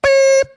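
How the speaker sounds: very high, loud mouth beep; squeaked beep; vocal bleep. Pretend. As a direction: imitate a censor bleep. Mock censor bleep before retake.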